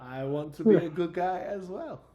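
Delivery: loud, high, hammed-up Italian-French accent. Character asking to join the good guys, French-Italian accent. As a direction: declare it eagerly in a broad mock Italian accent. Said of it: "fifty-year-old Italian man".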